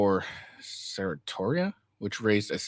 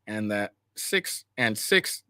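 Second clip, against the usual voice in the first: ordinary talk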